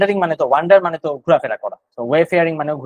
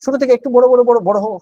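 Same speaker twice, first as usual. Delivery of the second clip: rising higher and louder as it grows; building up; 8 semitones higher, 5 dB louder than usual. Shown as a demonstration. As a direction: let each বড় swell bigger and louder. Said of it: "দূর থেকে আস্তে আস্তে ছোট".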